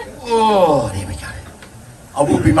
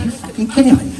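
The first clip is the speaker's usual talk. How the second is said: incredulous outburst; 12 semitones higher than usual. Retold or imitated. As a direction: pitch jumps high, blurted in disbelief. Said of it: "I said".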